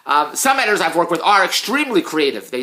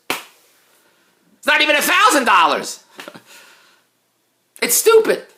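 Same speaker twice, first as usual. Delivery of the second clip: incredulous; 9 semitones higher, 3 dB louder, slower than usual. Real, not acted. Incredulous, slowed, high.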